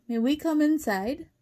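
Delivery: high, loud pleading question; begging. A narrator's reading. Wally begs to come inside from the wolves, pleading. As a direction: plead to be let in. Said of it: "begged Wally".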